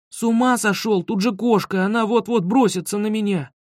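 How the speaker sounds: high-pitched frantic refusal; urgent, scared objection; panicked protest. A narrator's reading. Panicked refusal, cat about to pounce.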